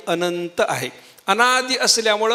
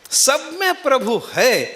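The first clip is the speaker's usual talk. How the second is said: slow, higher braying chant; donkey bray; 5 semitones higher, slower than usual. Retold or imitated. Preacher imitating the braying donkey, drawn out. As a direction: bray the line out slowly. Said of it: "केवढ्या जोरात ओरडला होता".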